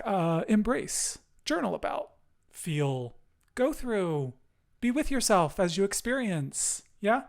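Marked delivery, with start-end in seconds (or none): none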